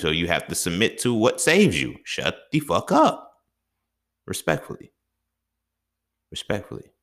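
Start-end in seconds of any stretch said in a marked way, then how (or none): 2.0-3.3 higher and slow; a high, slow mock snap; slow, drawn-out rebuke. 4.3-6.9 softer, breathy afterthought; a hushed tongue-in-cheek add-on; soft aside. Acted. Soft tag after insult.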